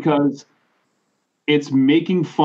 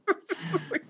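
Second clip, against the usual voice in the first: laughing; 13 dB quieter than usual. Real, not acted. Burst of laughter.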